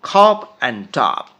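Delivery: খপ and top very slowly drawn out; slow model pair. Shown as a demonstration. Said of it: "মানে কাশি".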